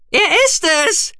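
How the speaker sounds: very high, loud squeal of joy; shrieked little cry of triumph; jubilant creature. A narrator's reading. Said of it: "jubelte Guki".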